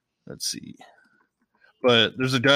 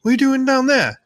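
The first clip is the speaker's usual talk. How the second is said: high, loud cheeky call; Stan calling; 14 semitones higher, 6 dB louder than usual. Retold or imitated. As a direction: shout down to him, amused. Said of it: "He's like".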